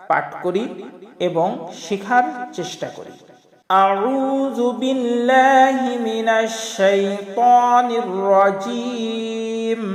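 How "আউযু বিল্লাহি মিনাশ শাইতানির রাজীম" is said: long drawn-out chanted recitation; slow Quranic recitation. Acted. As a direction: intone each word slowly and reverently. Recites opening formula slowly.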